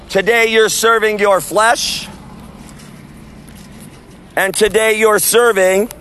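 measured, drawn-out preaching with long pauses; street sermon. Real, not acted. Street preacher warning the crowd outside the event, preaching. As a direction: call the passers-by to repent.

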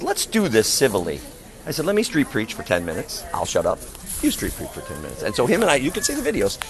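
ordinary talk

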